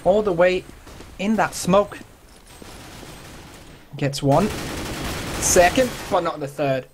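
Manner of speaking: high, fast excited call; esports caster. Acted. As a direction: rattle off the frags live. Live retake play-by-play.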